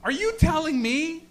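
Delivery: high-pitched, incredulous outrage; hammed-up horrified questioning; appalled disbelief. Acted appalled reaction to her news.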